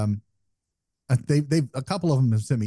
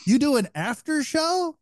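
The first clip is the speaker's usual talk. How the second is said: imitating baffled listeners, pitched way up; high, puzzled questioning, retold; mock incredulous; 11 semitones higher than usual. Retold baffled reaction of podcast hosts.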